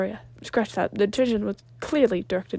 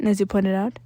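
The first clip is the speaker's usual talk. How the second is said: usual speaking voice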